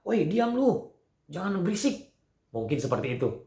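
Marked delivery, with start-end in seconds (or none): 0.1-2.1 high-pitched mock telling-off; scolding bystander. Man in front of crowd silencing beggar, mock scolding. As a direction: hush him in a raised, higher voice. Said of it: "orang yang berjalan mendahulu dia berkata".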